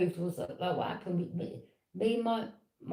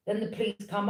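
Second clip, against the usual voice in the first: normal talking voice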